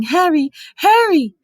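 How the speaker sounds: high, loud calls; calling out. A narrator's reading. Distant voices calling to Harry, loud and high. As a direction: call his name from far away.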